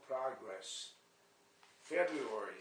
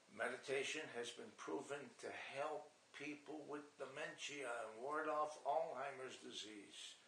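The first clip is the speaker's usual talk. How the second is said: ordinary talk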